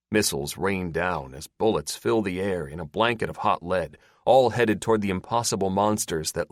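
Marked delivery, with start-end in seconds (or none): none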